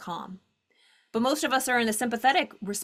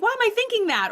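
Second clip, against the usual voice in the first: alarmed thought; 11 semitones higher, 4 dB louder than usual. Retold or imitated.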